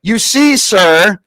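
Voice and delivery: ordinary delivery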